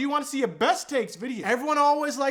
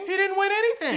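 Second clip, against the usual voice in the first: "He didn't win anything." repeated much higher with a stronger push; higher, stressed repeat; 11 semitones higher than usual. Real, not acted. Repeating his point to viewers, higher. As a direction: go up high on the repeat for emphasis.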